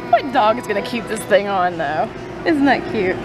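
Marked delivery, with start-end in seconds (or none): none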